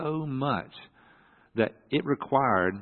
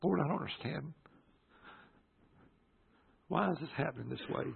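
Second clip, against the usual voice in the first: soft, hushed prayer; talking to God; 5 semitones higher, 9 dB quieter than usual. Retold or imitated. Son praying while his dad sleeps, hushed bewildered plea. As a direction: pray softly, questioning. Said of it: "I'm sitting here talking to God".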